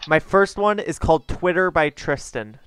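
usual speaking voice

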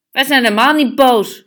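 loud, high indignant protest; angry denial. A narrator's reading. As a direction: protest far too loudly. (luid en verontwaardigd ontkennend)

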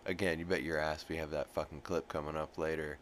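ordinary talk

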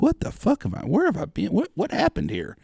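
stunned disbelief. Retold or imitated. Hearing she walks a pig, high stunned disbelief. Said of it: "I'm like".

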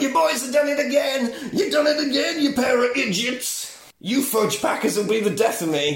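high-pitched exasperated rant in character; furious Drayton. Retold or imitated. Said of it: "and he's furious".

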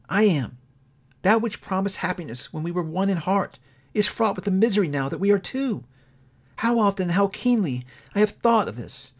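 a hint of a hurt young woman letting go; Belle, lightly voiced. A narrator's reading. Young woman to her changed lover, lightly voiced and sorrowful.

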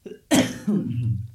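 real dry cough. Real, not acted. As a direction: cough into the mic. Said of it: "Sorry ya, gua lagi batuk-batuk".

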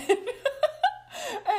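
high burst of real laughter; big laugh. Real, not acted. Laughing at host's swing joke.

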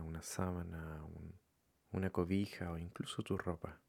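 calm, softened meditation voice with long pauses; guided meditation. Acted. Leading listeners to follow their breathing, calm meditation voice. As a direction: guide them gently, leaving room between phrases.

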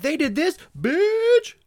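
hammed-up catty gossip; mock gossip. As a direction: snap the bitch out sassily.

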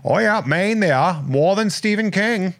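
a playful, hammed-up Maine accent. Shown as a demonstration. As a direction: ham up a Maine local. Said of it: "I can do that in a Maine accent".

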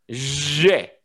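J in a Haitian French accent. Shown as a demonstration. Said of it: "Now you doing a Haitian accent".

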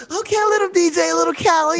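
put-on high, sweet coo to the kids; sweet godmother. Godmother cooing at the little kids, high and sweet. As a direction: soothe the little ones like a doting godmother.

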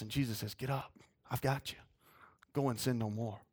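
low, soft and gentle; a preacher voicing Jesus quietly and kindly to the forgiven woman; tender Jesus voice. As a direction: speak softly to lift her up.

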